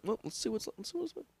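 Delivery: high, soft, lightly voiced old man; old man musing. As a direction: muse like a curious old man. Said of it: "I'd be like".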